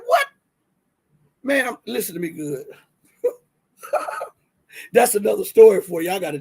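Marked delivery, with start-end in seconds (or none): 0.1-0.3 high-pitched startled yelp of disbelief; incredulous, pitched way up; retold shock. Retells shock at tooth cracking. 3.8-4.3 brief amused chuckle; small rueful laugh; short real laugh. Real, not acted. Laughs at own cracked mishap.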